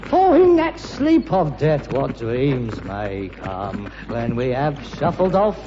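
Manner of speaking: slow, grandly declaimed Hamlet with big swoops; milk every word like a ham actor; hammy Shakespeare. Pretend. Son to father, theatrical Hamlet recital.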